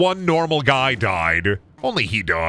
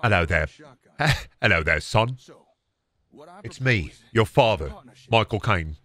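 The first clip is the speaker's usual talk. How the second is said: low Cockney impression; Michael Caine; 4 semitones lower than usual. Father greets his son, low Cockney impression. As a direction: speak short phrases in mellow Cockney. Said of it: "Michael Caine's easy".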